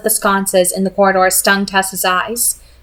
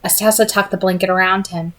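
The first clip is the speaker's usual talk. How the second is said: ordinary delivery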